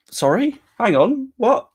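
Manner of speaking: high, loud incredulous questions; mock disbelief. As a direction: pitch leaping up in exaggerated bafflement.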